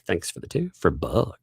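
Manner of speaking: usual speaking voice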